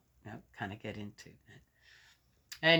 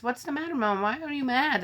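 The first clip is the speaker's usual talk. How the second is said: lightly voiced daughter, higher; a hint of a puzzled child asking Mom; daughter's voice; 9 semitones higher, 3 dB louder than usual. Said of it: "She says".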